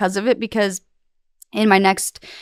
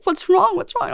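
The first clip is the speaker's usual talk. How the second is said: worried questioning; 10 semitones higher than usual. Retold or imitated. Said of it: "they're like".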